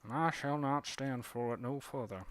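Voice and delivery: put-on a proud cardinal demanding civility, Southern accent. A cardinal grumbling about blue jays, affronted Southern accent. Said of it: "that's a cardinal talking about blue jays".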